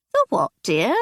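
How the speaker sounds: put-on puzzled old lady asking; older lady voice. Aunt mishears the request.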